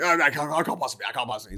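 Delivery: fast, flustered protest; mock-upset butler, words tumbling out; 動揺する執事. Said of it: "really upset now".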